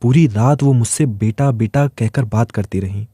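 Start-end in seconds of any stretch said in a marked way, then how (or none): none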